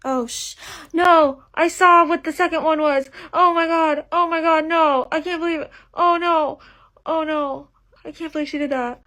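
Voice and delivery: high, loud cries of disbelief; dismayed shock. Real, not acted. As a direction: react to a spoiler you just saw. Accidentally spoiled by video title.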